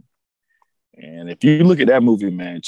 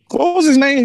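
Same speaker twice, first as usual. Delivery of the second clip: excited; 6 dB louder than usual. Real, not acted. Excited recall.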